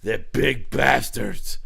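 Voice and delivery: slow, drawn-out grumble, dropping low at the end; mock disgust, stretched out slowly; imitated Vince. Host mimicking Vince reacting to Indians, slow mock growl. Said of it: "what, he, he sort of sees Indians, he's like".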